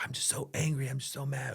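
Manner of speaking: put-on high, whispered complaint from a drained kid; worn-out son. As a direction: whisper it like an exhausted boy. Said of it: "He's like".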